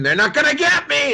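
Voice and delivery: high, loud pretend scream of paranoia; hammed-up shout of a paranoid buyer; mock scream. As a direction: yell it like they're coming for you. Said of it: "Just remember to scream".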